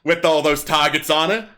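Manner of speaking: bawdy kobold comic on stage; kobold comedian. Acted. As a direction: perform it like a street comic working a crowd. Kobold comic tells bawdy joke.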